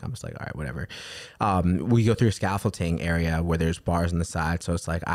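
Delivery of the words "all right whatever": much lower and softer, giving up; resigned mutter. Retold or imitated. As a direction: let it go under your breath. His own resigned reaction.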